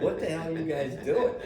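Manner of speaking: high-pitched imitation of baffled onlookers; amused, exaggerated disbelief; mock bewilderment. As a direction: act out an incredulous question. Baffled players' reaction acted out.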